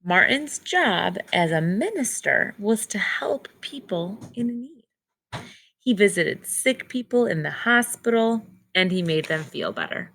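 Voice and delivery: clear, measured read-aloud storybook voice; reading to children. Acted. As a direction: read the book to the class slowly. Teacher reads picture book aloud.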